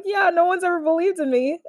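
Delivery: high, small voice, lightly acted; a hint of a sad young mugger confessing; meek mugger. Mugger telling Iroh nobody believed in him, lightly voiced and high. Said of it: "he's like".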